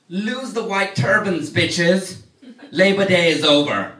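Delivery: loud, high, hammed-up scolding; sassy mock command; mock fashion police. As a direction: lay down the fashion law at full volume. Plays fashion police scolding offenders.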